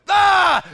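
high, loud startled yell; startled scream. Retold or imitated. Retelling waking up in church, startled scream. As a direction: re-enact jolting awake with a yelp. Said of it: "and I went".

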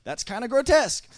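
high, loud imitation of disbelief; mock shocked reaction. Retold shocked reaction to friend.

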